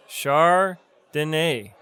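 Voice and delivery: Chardonnay drawn out in parts, shar... duh-NAY; slow pronunciation. Shown as a demonstration. Teacher breaks down a wine name, slow pronunciation. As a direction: slowly split Chardonnay into its sounds. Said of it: "That's how you pronounce".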